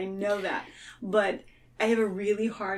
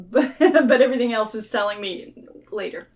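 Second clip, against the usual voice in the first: laughing while she talks; a real laugh through the line; 3 semitones higher, 11 dB louder than usual. Real, not acted. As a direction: laugh the words out. Laughing at her own excuse.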